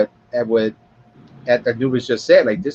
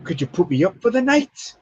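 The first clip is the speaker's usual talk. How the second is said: acted punchline; 7 semitones higher than usual. Acts the crow's punchline.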